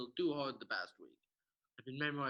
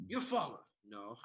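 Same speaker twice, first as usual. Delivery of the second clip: normal talking voice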